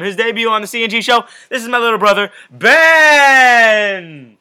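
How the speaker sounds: big hype announcer call-out; announcer intro. Real, not acted. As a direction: announce him like a game show host. Announcing brother on podcast.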